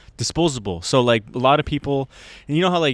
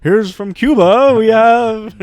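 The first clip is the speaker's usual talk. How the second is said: loud, slow, drawn-out introduction; mock showbiz announcement; TV announcer; 9 semitones higher, 8 dB louder, much slower than usual. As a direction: boom it like a talent-show host. Imitating TV talent show announcer.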